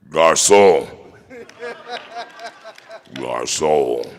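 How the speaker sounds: deep, loud stern-father call in French; character catchphrase. Pretend. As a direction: command the boy in a very low voice. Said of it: "your variation of boy in different languages".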